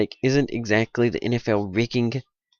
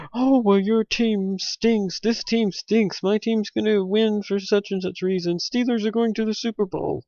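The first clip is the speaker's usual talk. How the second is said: mock angry fan; 9 semitones higher than usual. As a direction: ham up a scoffing fan yelling back. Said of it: "feel free to yell at me".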